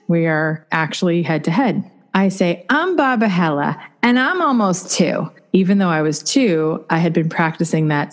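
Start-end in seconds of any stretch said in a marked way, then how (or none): 2.7-5.3 put-on high little-girl voice, proud and rehearsed; a higher child's voice reciting a practiced line; toddler voice. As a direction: play your two-year-old self announcing her age. Toddler self introducing herself.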